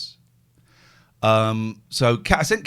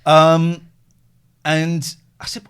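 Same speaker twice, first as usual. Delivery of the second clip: ordinary delivery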